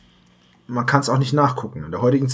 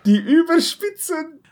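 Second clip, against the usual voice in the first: barkeeper voice; 13 semitones higher than usual. Acted. Mo serves the joke dish.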